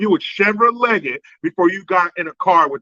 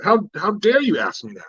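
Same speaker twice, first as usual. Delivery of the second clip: ordinary delivery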